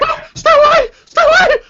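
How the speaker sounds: very high, very loud panicked screaming; terrified shriek. Acted. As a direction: shriek it in terror. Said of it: "this woman shrieking".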